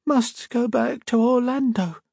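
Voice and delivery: put-on high, slow, dazed child voice; feral sick girl. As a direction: drone it out as if entranced. Feral girl repeating voices' command.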